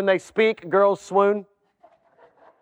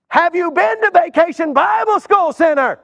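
higher, pressing gatekeeper; gatekeeper interrogating; 5 semitones higher than usual. Acted. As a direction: keep grilling the newcomer. Gatekeeper questions a newcomer at heaven.

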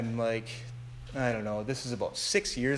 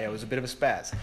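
ordinary talk

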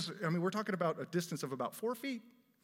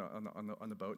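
ordinary talk

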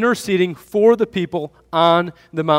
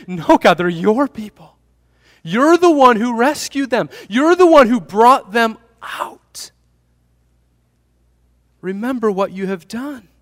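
much higher and louder, an urgent plea; pleading Moses; 7 semitones higher, 6 dB louder than usual. Acted. Preacher voicing Moses arguing with God, urgent and much higher. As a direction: beg God to remember his own people.

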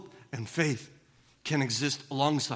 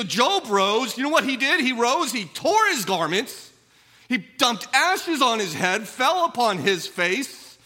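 loud, raised sermon voice; preaching at full force; 8 semitones higher, 7 dB louder than usual. Acted. Preacher correcting stoicism, loud and driving. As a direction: hammer the point home to the church. Preacher pressing a point on grief.

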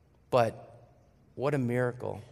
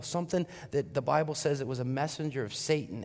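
ordinary talk, nothing marked

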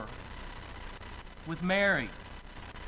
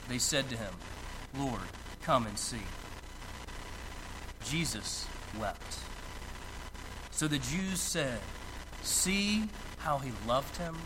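hushed grieving reading; 7 semitones lower, 6 dB quieter than usual. Acted. Preacher reads of Jesus weeping, hushed and grieving. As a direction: lower and much softer, slowing at the sorrow.

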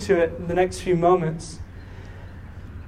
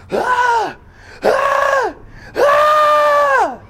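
high repeated screams, building louder; imitated child's scream; 4 dB louder, much slower than usual. Father imitating his daughter screaming at the table, high shrieks. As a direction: shriek like a panicking little girl, much higher. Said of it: "Nora starts screaming".

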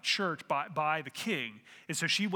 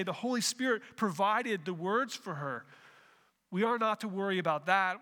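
ordinary delivery

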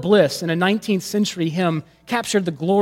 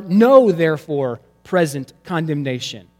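"Know" punched loud at the front, then a pause; stress on Know; 4 dB louder than usual. Shown as a demonstration. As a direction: hit "Know" hard and let it hang before "therefore".

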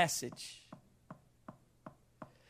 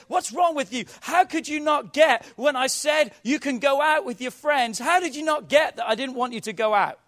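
loud, high, accusing shout at a spouse; angry shout; 7 semitones higher, 7 dB louder than usual. Acted. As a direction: yell it like a fed-up spouse. Acted marital quarrel, shouting.

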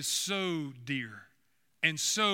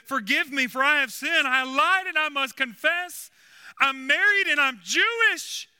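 mock crying; 8 semitones higher, 6 dB louder than usual. Cabbie confesses his lie to the nun, mock crying. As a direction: wail it out in a hammed-up sob. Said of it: "he starts crying and crying and crying".

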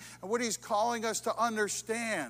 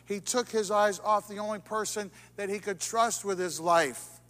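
louder, driving repetition of took his eyes off; building emphasis; 6 dB louder than usual. Real, not acted. Preacher driving home Peter's mistake, louder and louder. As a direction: press each line harder.

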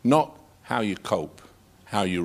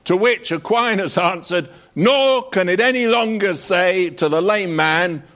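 higher, lively storytelling; relished anecdote; 8 semitones higher, 4 dB louder than usual. Acted. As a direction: tell the Pope and Aquinas tale with gusto. Telling Aquinas anecdote with relish.

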